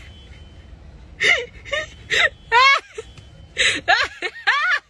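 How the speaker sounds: shrieking high laugh that tips into crying; screaming laugh fit; hysterical laughter. Real, not acted.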